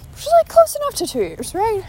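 hopeful squeak, way up high; high squeaky voice. Real, not acted. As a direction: squeak it out, half-joking. Hopeful self-justifying squeak.

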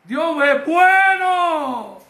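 long, high shout of praise; slow, exultant preacher's shout. Shown as a demonstration. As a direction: cry it out jubilantly, stretching the words.

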